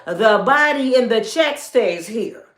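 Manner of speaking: a wife setting her terms, slow and loud; slow, loud and firm. Retold or imitated. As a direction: spell out each word like a final ruling. Wife laying down law.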